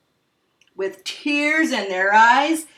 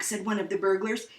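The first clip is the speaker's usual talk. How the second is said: ordinary delivery, nothing marked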